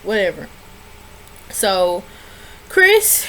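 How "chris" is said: incredulous call. Retold or imitated. Calling Chris in disbelief.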